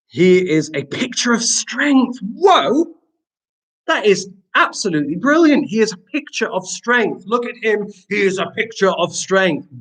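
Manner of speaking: high, excited admiration; amazed delight. Real, not acted. Teacher praising a pupil's sentence to the class, amazed delight.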